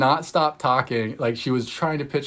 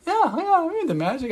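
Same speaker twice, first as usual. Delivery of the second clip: much higher, gushing fan voice; eager stranger. Retold or imitated. Tattooed stranger eagerly telling her he loves magic, much higher. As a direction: play the excited stranger agreeing.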